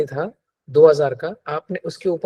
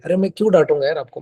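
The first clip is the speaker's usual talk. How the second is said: ordinary delivery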